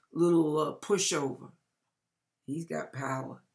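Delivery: usual speaking voice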